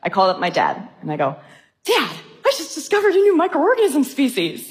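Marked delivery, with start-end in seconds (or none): 1.8-4.7 high, thrilled retelling of big news; excited announcement. Retold or imitated. Daughter phoning her dad with a discovery, excited and high. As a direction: burst out with proud news, much higher.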